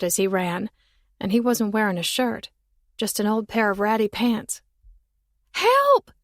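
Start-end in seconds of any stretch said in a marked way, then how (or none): 5.5-6.1 high, loud cry for help; girl shouting. Acted. Girl in a tree calling to a boy, loud shout. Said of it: "she shouted again".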